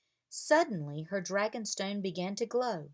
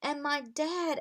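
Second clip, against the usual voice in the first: higher, eager and happy; excited add-on; 8 semitones higher than usual. A narrator's reading. Girl excited to see her dad, chiming in high. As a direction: chime in with joy. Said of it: "added Rory".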